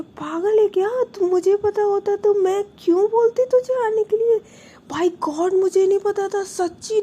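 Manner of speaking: high, loud, disbelieving protest. Retold or imitated. Sister protests loudly to her sobbing younger sister, high and loud. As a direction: insist loudly that you truly didn't know. Sister swears she didn't know.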